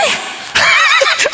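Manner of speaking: high, sudden burst of laughter. Real, not acted. Caught out by God's condition, laughing high. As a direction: laugh at the irony.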